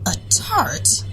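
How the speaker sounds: a high, breathy shocked echo. Acted. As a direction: repeat it in disbelief. Woman shocked at insult.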